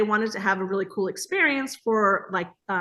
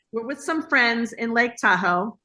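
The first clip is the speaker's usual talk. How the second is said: usual speaking voice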